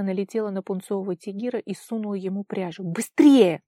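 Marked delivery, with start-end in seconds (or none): 2.8-3.6 loud, urgent command; ordering through teeth. A narrator's reading. Vara hurries her friend in the wind, tense loud order. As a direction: force it out through gritted teeth. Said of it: "сквозь зубы приказала она".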